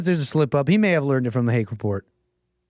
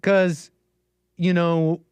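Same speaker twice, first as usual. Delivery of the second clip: usual speaking voice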